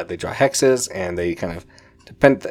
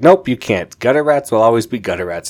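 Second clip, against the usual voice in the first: loud, higher, scornful brush-off; dismissive jerk; 3 semitones higher, 7 dB louder than usual. Retold or imitated. Victor kicking the grown kids out, loud and scornful. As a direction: sneer it down at them. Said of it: "He's like".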